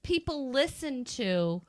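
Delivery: ordinary delivery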